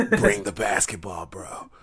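very low, fading murmur; Moxley's real talk. Retold or imitated. Wrestler repeating his request to opponent, hushed low imitation. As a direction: trail down to a near-silent low voice. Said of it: "That's how he really talk".

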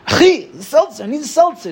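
high, pleading voice to the shopkeeper; frantic customer begging the Israeli shopkeeper for seltzer; desperate plea. Retold or imitated. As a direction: beg for a seltzer at closing time. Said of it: "And you're just like".

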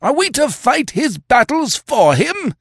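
a hillman howling his grievance at the chief; shrill, furious howl of complaint. A narrator's reading. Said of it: "he howled".

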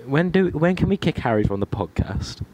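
usual speaking voice

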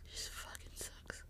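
slow, barely audible whisper; fading whisper. Real, not acted. Repeats fading away.

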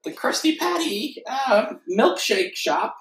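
high-pitched goofy sponge voice; name the shop in a high cartoon voice. Sponge telling co-host where he works, goofy cartoon voice. Sponge character naming workplace.

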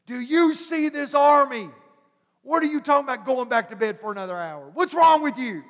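what is wrong with you, urgently; exasperated outburst. Retold or imitated. Servant scolds Elisha over the army, exasperated and fast.

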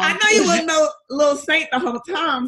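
high, loud teasing sing-song; loud, high, mock-accusing tease; playful teasing. Real, not acted. Teasing host about being no saint.

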